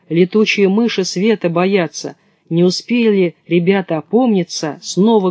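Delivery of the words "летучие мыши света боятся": ordinary delivery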